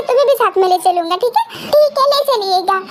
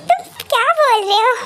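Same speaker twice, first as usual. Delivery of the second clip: ordinary delivery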